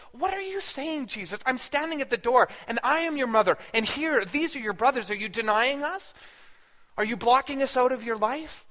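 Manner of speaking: high-pitched, incredulous protest; jolted, indignant. Retold or imitated. Mother at the door to the son denying her, indignant. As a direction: challenge him as a hurt, disbelieving mother. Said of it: "That jolted me".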